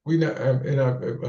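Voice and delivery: halting, tripping over the words; stuttering. Real, not acted. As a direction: stumble through the start. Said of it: "I'm stuttering because I'm like".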